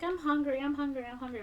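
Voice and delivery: high, soft mock whine; complaining. Imitating a woman complaining of hunger to her companion, mock whiny. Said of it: "she was complaining that she was hungry".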